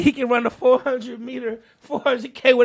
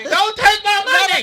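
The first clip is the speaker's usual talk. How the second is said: high character voice; 8 semitones higher than usual. Acted. Bit high voice.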